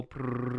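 rolled-tongue drum roll, slowed right down. Shown as a demonstration. Host builds suspense before the answer with a mouth drum roll. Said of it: "drum roll".

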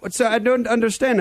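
put-on baffled Indian-accented caller; put-on Indian accent, confused. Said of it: "with a strange Indian accent".

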